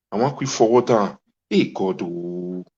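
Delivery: ordinary delivery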